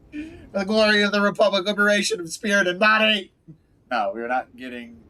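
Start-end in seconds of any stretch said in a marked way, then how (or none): 0.1-3.3 high, loud, hammed-up proclamation; grandiose. Player proclaiming the glory of the Republic, mock grandiose. Hams up the drama dialogue option.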